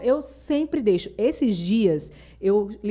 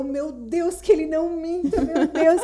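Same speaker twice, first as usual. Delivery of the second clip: mock prayer; 7 semitones higher than usual. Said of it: "E eu".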